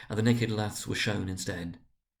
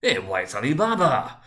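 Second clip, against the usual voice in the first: slow, loud, higher cry of delight; 1 excited exclamation; 4 semitones higher, 6 dB louder than usual. A narrator's reading. Scrooge cries out in ecstasy over his storybook hero, loud and slow. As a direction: announce it like a thrilled child.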